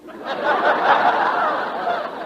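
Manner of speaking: sudden high laugh at the news; a hearty amused laugh; burst of laughter. Acted. Kingfish hears "no motor.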